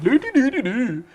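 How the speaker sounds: a playful jab at the neighbours' sing-song; mock Swedish singsong. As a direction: imitate the lilting Swedish-Norwegian tune. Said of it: "they go more like".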